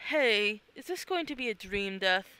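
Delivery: ordinary talk, nothing marked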